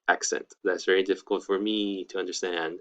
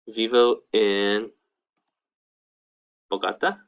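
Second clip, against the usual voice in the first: slowly spelled-out Spanish phrase; slow model phrase; slower than usual. Shown as a demonstration. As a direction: say it slowly so students can follow. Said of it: "Where do you live?".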